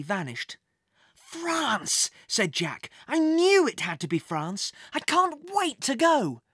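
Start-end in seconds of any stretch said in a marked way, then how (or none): 1.1-2.1 put-on high, delighted boy's cry; thrilled young agent; excited exclamation. A narrator's reading. Boy delighted at his destination. 3.1-6.4 rising, high-pitched enthusiasm; thrilled boy who guessed right; eager excitement. A narrator's reading. Boy eager for his mission.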